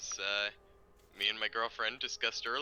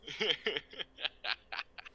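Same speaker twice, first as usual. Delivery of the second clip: soft, higher giggle; 4 semitones higher, 5 dB quieter than usual. Real, not acted. Friend giggles after calling pal senpai, soft laughter. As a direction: giggle softly at your own joke.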